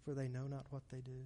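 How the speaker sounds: very soft, hushed plea; Jesus. Acted. Preacher voicing the prayer of forgiveness, hushed. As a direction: quote it low, almost under your breath. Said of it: "can you hear his voice that says".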